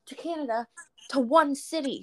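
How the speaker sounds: choppy mock rant with pauses; ranting friend. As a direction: imitate an indignant friend. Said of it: "she was going on a rant".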